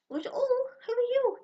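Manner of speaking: high, surprised little coo. Real, not acted. Finding a goat on the soap label, high surprised coo.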